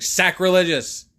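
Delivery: drawn-out scolding call; mock condemnation. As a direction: call it out slowly like a preacher. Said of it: "walk by every church with stairs and be like".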